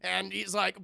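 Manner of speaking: ordinary talk, nothing marked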